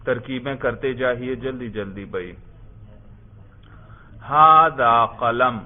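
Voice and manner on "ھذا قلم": drawn-out words with pauses for writing; measured, stretched-out classroom dictation; slow dictation. Shown as a demonstration. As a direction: dictate it slowly to the class, word by word. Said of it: "اب آگے لکھیے".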